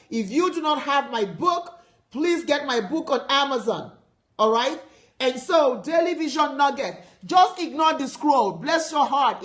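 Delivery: ordinary talk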